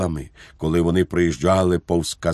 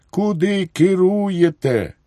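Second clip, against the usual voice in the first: high, drawn-out agitated question; agitated demand; 12 semitones higher than usual. A narrator's reading. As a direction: demand it slowly in a much higher voice. General challenges his driver's steering. (схвильований вигук високим голосом)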